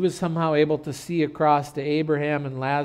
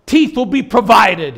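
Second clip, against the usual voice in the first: loud, high preacher's comeback yell; yelled retort; 8 semitones higher, 14 dB louder than usual. Retold or imitated. Flustered pastor answering the farmer, yelled. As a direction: shout the punchline back at the heckler. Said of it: "he yelled at him".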